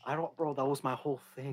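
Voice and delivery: low, very soft, deflated; quiet, low admission of defeat. Real, not acted. Friend whose whole point got stolen, deflated and quiet. His prepared point was taken.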